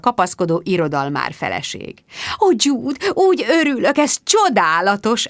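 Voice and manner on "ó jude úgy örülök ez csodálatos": high, effusive greeting; effusive high-pitched rapture; gushing. A narrator's reading. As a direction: gush with overdone delight. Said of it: "áradozott".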